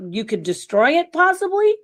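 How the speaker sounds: ordinary talk, nothing marked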